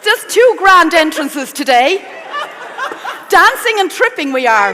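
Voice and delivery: high, loud, laughing exclamations; loud joking welcome. Real, not acted. Chef greets her stumbling co-host on stage, loud amused teasing. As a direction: call out teasingly over the laughter. Joking loudly after presenter trips.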